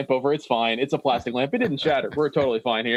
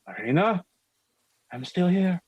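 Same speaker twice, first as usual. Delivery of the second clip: higher-pitched character voice, rising on the name; Ismark; 6 semitones higher than usual. Acted. Man calls to his companion in the fog, higher-pitched voice. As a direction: call out lightly in a higher voice. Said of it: "you hear Ismark say".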